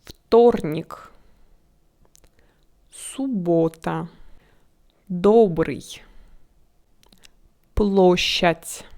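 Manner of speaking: Вторник, Суббота, Добрый, Площадь one at a time, stressed o round and full; stressed O sound. Shown as a demonstration. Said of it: "but with an accent like O".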